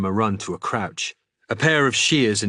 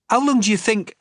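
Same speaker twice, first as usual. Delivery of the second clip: eager question; 13 semitones higher, 5 dB louder than usual. Acted. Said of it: "started Udza".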